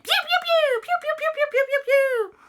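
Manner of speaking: very high rapid laser noises; laser zaps. Acted. Christopher keeps firing at the ghost, squeaky zapping. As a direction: squeal a long volley of shots.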